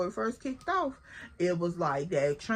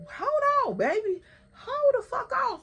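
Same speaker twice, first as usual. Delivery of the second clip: much higher, louder call to hold off; shouting across; 13 semitones higher, 4 dB louder than usual. Retold or imitated. Telling Slim to hold off, a high loud shout. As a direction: yell across the crowd to stop her friend. Yelling to stop friend.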